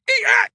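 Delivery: very high two-note alien squeal; a shrill creature call from underwater. A narrator's reading. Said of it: "it said".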